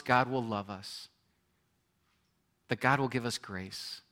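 ordinary talk

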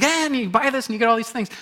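preacher mimics a TV pitchman, mock hype; ShamWow pitchman. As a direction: sell it like a late-night ad. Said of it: "a ShamWow, uh, commercial".

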